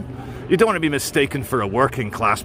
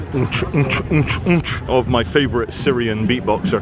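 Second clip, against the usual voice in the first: beatbox imitation; 3 semitones lower than usual.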